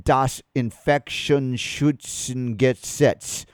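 "Das Infektionsschutzgesetz" spelled out slowly, syllable by syllable, in halting German; struggling German word. Shown as a demonstration. Radio host attempting the German law name: slow and halting. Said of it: "I can't even say it".

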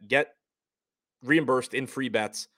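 ordinary talk

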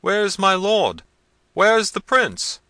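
high, long-drawn searching shout; calling out. A narrator's reading. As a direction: call slowly through the vaults for your master. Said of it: "calling out".

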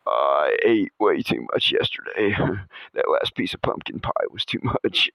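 low, fading groan of an overfull sleeper; drops to a low near-whisper by the end; groggy complaint. Retold or imitated. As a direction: mumble it, stuffed and sleepy. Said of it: "It's like".

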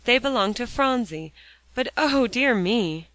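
mildly sharp outcry. A narrator's reading. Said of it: "cried Polly sharply".

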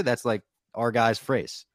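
usual speaking voice

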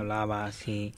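slow, soft robotic monotone; toy robot voice. Retold or imitated. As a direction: imitate a voice box, slowly. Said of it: "la voz de robot".

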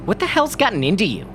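quick, loud, barked question. A narrator's reading. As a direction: snap at him. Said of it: "barked Arthur".

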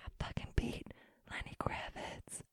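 breathless whisper; awed whisper. Retold or imitated. Seeing her name above [name], whispering. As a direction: hush it like a secret triumph.

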